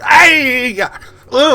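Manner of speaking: high, loud cry of effort, then a grunt; hammed-up lifting noises; straining yelp. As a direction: yelp as you heave a heavy load. Cabbie struggles with heavy bag.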